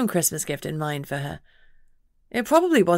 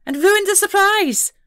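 high, slow, louder pixie voice; irritated pixie buzz; 6 dB louder than usual. A narrator's reading. Pixie to the detective, buzzing in high irritation. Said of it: "the pixie buzzed in irritation".

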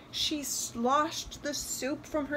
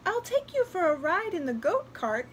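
put-on higher little-girl voice; 7 semitones higher, 3 dB louder than usual. A narrator's reading. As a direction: offer it as a small child. Girl character offers a ride.

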